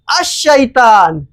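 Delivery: अश-शैतान slowly, with the लाम dropped; sun letter assimilation. Shown as a demonstration. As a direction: say अश-शैतान, doubling the sh. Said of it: "उसी तरह से देखिए यहाँ".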